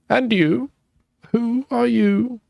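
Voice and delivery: put-on high, slow old man's question; creaky ancient ghost asking. Voicing the old man.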